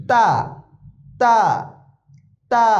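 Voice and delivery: তা light and thin, tongue tip at the teeth; touch the tongue tip to the upper front teeth for a light তা. Shown as a demonstration. Teacher separating two confused letters, thin light তা. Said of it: "হালকাভাবে মুখ খুলবে".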